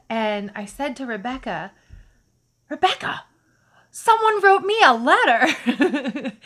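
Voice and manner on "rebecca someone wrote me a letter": louder, higher, slow giddy laughter; giddy laughing announcement; laughing with joy. Retold or imitated. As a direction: laugh the news out in delight. Telling friend, laughing.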